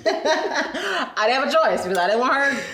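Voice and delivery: loud, high, amused protest; real laughing. Real, not acted. Woman explaining why she obeyed her friend's threat, laughing.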